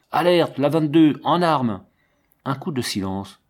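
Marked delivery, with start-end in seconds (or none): 0.1-1.8 a sudden loud call to arms, pitched up; shouted alarm. A narrator's reading. A figure at the dugout entrance calls the squad to arms, loud shout. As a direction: bark the alert into the dugout. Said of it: "et crie".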